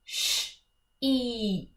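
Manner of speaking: Sh-eep with the ee stretched long; sounding out words. Shown as a demonstration. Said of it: "I'll show you what that looks like".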